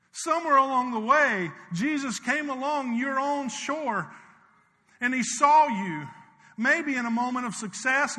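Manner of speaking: loud, high, driving preacher's call; preaching at full force. Acted. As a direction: preach it out to the whole church, rising and loud.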